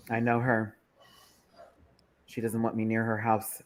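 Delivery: normal talking voice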